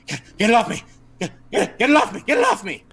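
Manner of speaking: high, stammered mock panic; panicked. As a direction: yelp it out in acted panic, pitch climbing. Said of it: "he looks panicked and he says".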